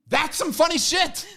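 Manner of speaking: laughing through the words, high; cracking up at the joke; giggling it out. Real, not acted.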